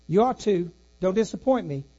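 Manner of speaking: ordinary delivery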